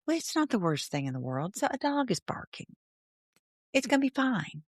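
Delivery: relaxed reassuring inner voice; calm reframed thought. Shown as a demonstration. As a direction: brush it off, it's fine. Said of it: "but the thought".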